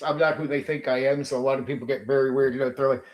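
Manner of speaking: ordinary delivery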